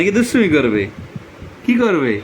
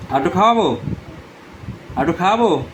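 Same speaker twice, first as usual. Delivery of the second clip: ordinary talk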